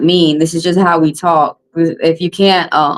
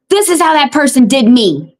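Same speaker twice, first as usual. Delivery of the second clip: raised, pitched-up hollered complaint; mock holler; 7 semitones higher than usual. Telling the neighbourhood who did her wrong, in a mock holler. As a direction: call it out like spreading the word around town. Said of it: "teach us to go around holler and say".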